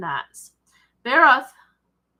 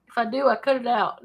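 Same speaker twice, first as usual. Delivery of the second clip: normal talking voice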